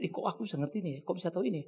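a hint of private astonishment; soft wonder to self. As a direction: murmur it in quiet surprise. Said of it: "Kadang-kadang saya pikir".

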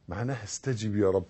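normal talking voice

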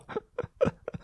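quiet, breathy self-amused laugh; soft chuckle. Real, not acted. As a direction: chuckle softly to yourself. Chuckles at own recurring rant.

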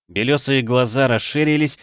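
usual speaking voice

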